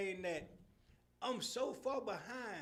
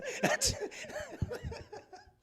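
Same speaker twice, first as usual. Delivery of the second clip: high burst of laughter; 4 dB louder than usual. Real, not acted. Laughs high while telling the studio story.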